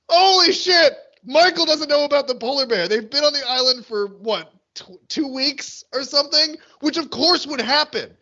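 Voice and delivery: very high, astonished exclamation; amazed excitement. Retold or imitated. Podcaster retelling their reaction to the episode, amazed. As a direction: blurt it out high, bursting with discovery.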